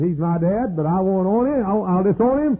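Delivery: hammed-up voice of a cold daughter; preacher mimicking his daughter disowning him; mimicked daughter. Preacher voicing daughter's words.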